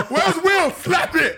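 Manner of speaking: high-pitched mock demand through laughter; laughing, excited mock yell; hammed-up outburst. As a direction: call it out, cracking up. Acting out outburst while cracking up.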